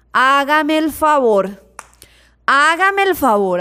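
slow, drawn-out exasperation; exasperated disbelief. Retold or imitated. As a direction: stretch each word in mock indignation. Reacción indignada a lo que cuentan. (exasperación, arrastrando las palabras)

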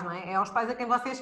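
ordinary talk, nothing marked

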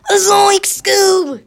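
high, slow cartoon Shaggy; Shaggy impression. Kid announcing his Shaggy voice, high and loud. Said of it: "I'm Shaggy!".

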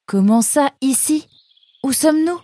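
questions aiguës et pressantes; Nemrod inquiet. A narrator's reading. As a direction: jeune renard perdu qui s'affole. (Nemrod demande où il est, voix aiguë et inquiète)

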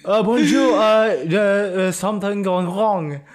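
put-on joking French accent; mock French accent. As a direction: ham up a fake French accent. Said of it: "just make it convincing".

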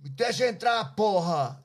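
put-on loud, high drunken demand in Portuguese; mock drunk yelling. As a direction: bang on the door and shout to be let in. Drunk Kurt demanding entry.

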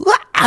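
sudden high shriek; scream. Retold or imitated. Terrified woman flees the monster, high scream. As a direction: scream and run. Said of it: "이러면서".

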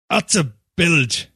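plain reading voice

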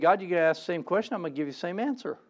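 fast, tossed-off reply; quick resigned aside; weary rattling off. Acted. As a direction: rush it like a tired excuse. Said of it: "He said".

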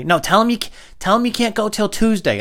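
feed lines like a pushy parent; bossy parent. Retold or imitated. Parent whispering instructions.

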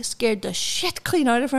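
normal talking voice

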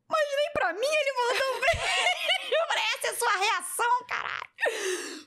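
very high, drawn-out comic wail; mock crying mother. Mother protesting she got no kiss, hammed-up tearful wail. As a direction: imitate a wailing mother, breaking into laughter. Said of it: "E a minha mãe chorava".

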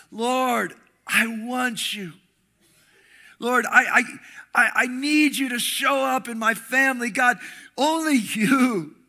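loud, pleading prayer, slow in places; impassioned prayer. Acted. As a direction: pray it out from the heart. Leads a heartfelt prayer.

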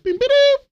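very high-pitched electronic chime noise; imitated device beep. Imitating Lana's alert for friends, high mock beep. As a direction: sing out a little two-part beep. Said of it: "you hear the little beep".

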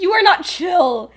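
loud, high mock outburst; hammed-up indignant yell; exasperated accusation. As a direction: throw the accusation out loudly. Acted quarrel.

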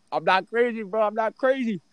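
high-pitched insistence, acted out; retold frantic protest. Retold protest to doubting friend.

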